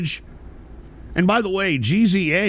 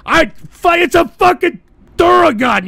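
sputter, then burst into a high shout; loud, high, outraged correction; exasperated yell; 10 semitones higher, 7 dB louder than usual. Real, not acted. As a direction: yell it at an idiot. Yelling at chatter who mocked him.

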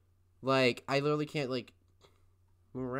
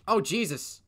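startled oh Jesus; 7 semitones higher, 8 dB louder than usual. Real, not acted. Reacting to boy in locker.

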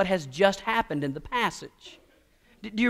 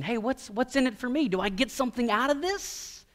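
put-on higher-pitched child's question, rising at the end; child asking; 5 semitones higher, 4 dB quieter than usual. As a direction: ask like a kid angling for a reward. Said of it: "even children, they learn to ask this question".

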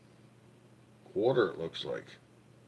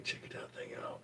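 whisper; 18 dB quieter than usual. Real, not acted. Awed by a find.

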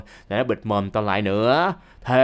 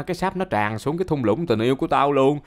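usual speaking voice

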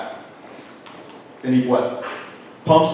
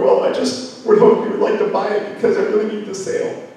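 pleading salesperson; 6 semitones higher than usual. Retold or imitated. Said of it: "And you'll go".

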